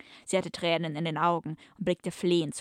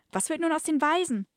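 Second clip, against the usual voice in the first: much higher, louder mock-girlish voice; high damsel question; 10 semitones higher, 4 dB louder than usual. Rescued woman asks her captain, mock-girlish high voice. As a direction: ask it like a wide-eyed heroine.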